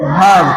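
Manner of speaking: verb with silent R, like "vab"; correct pronunciation. Shown as a demonstration. Teacher models verb for the class, correct pronunciation. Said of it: "इसे क्या पढ़ेंगे".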